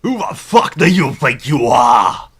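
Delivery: loud, sneering pig-man thug. Acted. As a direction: bark it like a brutish pig. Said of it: "you gotta do a pig voice".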